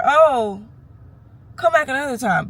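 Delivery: mocking high-voiced dismissal; mock dismissive doctor.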